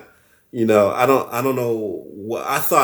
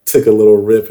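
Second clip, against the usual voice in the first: ordinary talk, nothing marked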